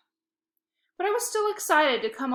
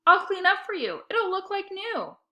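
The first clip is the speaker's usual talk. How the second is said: higher, sweet big-sister voice; smiling sister; 10 semitones higher, 4 dB louder than usual. A narrator's reading. Sister teasing dad about his messy apartment. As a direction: say it with a smile.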